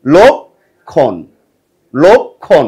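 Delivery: লক্ষণ stretched very slowly as "lokkhon"; লক্ষণ modelled slowly as "lokkhon"; slow clear pronunciation. Shown as a demonstration. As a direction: draw লক্ষণ out, "lok-khon". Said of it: "কি বলবো?".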